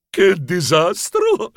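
slow, drawn-out lament; dismayed exclamation. Acted. Director watching everything go wrong, slow dismay. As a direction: bemoan it dramatically. Director despairs as rehearsals fail.

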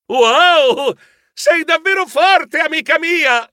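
amazed praise for his friend; admiring exclaim. Acted. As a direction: gush with admiration. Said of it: "esclamai ammirato".

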